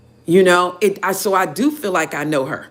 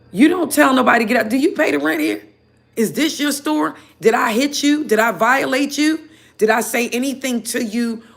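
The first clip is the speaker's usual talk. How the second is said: indignant rant; 6 semitones higher than usual. Real, not acted. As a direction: fire each question back in disbelief.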